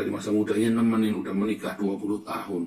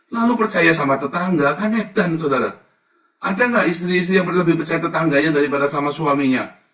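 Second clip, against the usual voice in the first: loud, much higher, fiery sermon delivery; preaching at full force; 5 semitones higher, 9 dB louder than usual. Acted. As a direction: warn the flock with fire. (khotbah lantang penuh semangat)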